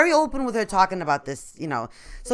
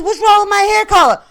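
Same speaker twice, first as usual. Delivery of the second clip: indignant; 9 semitones higher, 7 dB louder than usual. Retold or imitated. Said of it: "I said".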